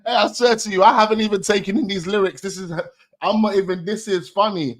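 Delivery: loud, high, rushed amazement; can't believe what he just heard; real excited outburst; excited disbelief. Real, not acted. Stunned by the diss lyrics.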